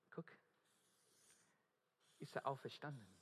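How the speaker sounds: usual speaking voice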